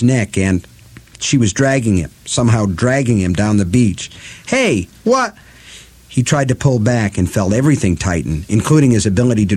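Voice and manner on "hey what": sudden high startled yelp. Acted. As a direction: cry out in surprise. Eddie startled.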